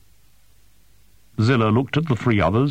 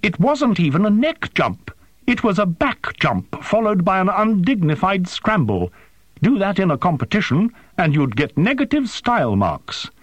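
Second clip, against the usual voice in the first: high, scathing rant; incensed drone voice; spitting with fury; 12 semitones higher than usual. A narrator's reading. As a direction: tear into them in a high, furious voice. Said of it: "spitting with fury".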